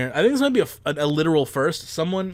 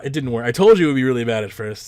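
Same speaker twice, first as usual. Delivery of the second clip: normal talking voice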